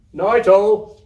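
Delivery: loud high shout. Acted. Storeman shouting goodnight to all, loud and high. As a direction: call it out to everyone. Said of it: "he shouted".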